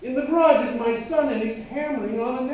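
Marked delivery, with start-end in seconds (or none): none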